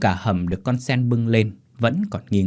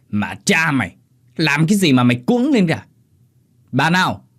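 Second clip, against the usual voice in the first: irritated master, getting louder; angry scolding; 6 semitones higher, 5 dB louder than usual. Acted. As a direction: scold a flustered servant. Master scolds the panicking servant. (ông chủ quát mắng)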